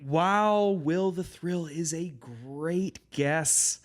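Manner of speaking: high, slow, drawn-out fake wow; mock amazement. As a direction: play up the awe over the guess. Mock praise of guess.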